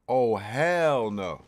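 loud, slow, drawn-out protest; outraged refusal. Real, not acted. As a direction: stretch HELL in loud outrage. Refusing to replay the section.

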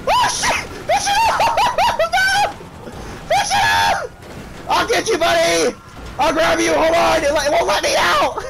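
high, frantic gamer yelling; panicked shouting. Real, not acted. Frantic yelling during game chaos.

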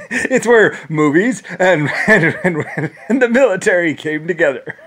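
words broken up by real laughter; laughing while talking. Real, not acted. Amused at his own story, laughing through the words. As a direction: giggle through the line.

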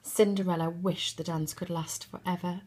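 plain reading voice

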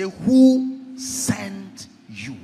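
very slow, drawn-out questioning; imperious challenge. Acted. Pharaoh asks Moses who sent him, imperious challenge. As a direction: stretch each word as a ruler's challenge.

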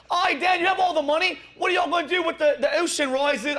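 high-pitched mock Australian lady; bad Australian accent. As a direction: imitate an Australian heckler with a clumsy accent. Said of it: "that was the worst Australian English accent".

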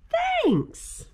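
high squeaky bear thanks; grateful little bear voice. Acted.

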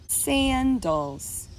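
"Sandals" slowly as saan, dals; syllables drawn out. Shown as a demonstration. Teacher lets preschoolers hear the parts, "Sandals" drawn out in two. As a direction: stretch both syllables of "Sandals". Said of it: "how many syllables we hear".

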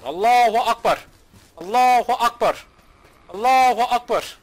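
loud, slow, drawn-out battle cry; mock zombie war cry. Streamer charging other players in game, mock zombie yell. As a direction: charge in hollering like a crazed zombie. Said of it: "Ben burada zombi taklidi yapıyorum".

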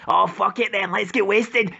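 normal talking voice

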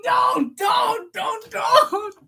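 high, loud don't don't; pleading shout. Real, not acted. Streamer begging chat not to draw it, loud high plea. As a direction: yelp it out in protest.

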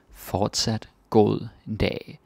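"Fortsat god dag" drawn out slowly; slow careful demo. Shown as a demonstration. Teacher repeating the phrase slowly for students. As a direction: break it down slowly for learners.

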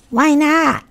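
put-on high, bright young voice; innocent question. A narrator's reading. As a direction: ask it simply. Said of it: "asked Wilbur".

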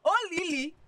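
loud, high, hammed-up begging; wailing plea. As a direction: wail her name, dragging out the cries. Friend begs in exaggerated wailing.

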